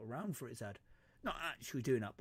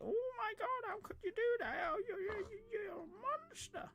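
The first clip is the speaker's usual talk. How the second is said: very high, wailing falsetto protest; hammed-up outrage in a squeaky falsetto; mock horrified pig. Pig accuses player of being a monster, mock wail. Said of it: "Don't act all like".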